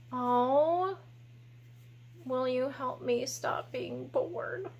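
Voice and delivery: a bored little potato asking meekly; lightly voiced shy puppet. Puppet potato asks for help.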